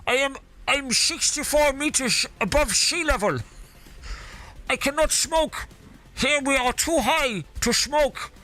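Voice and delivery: put-on Dutch accent. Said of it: "Do you like my Dutch accent?".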